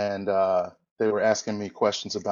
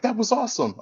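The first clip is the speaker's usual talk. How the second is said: excited quote; 11 semitones higher than usual. Retold or imitated. Wrestler's excited reaction retold.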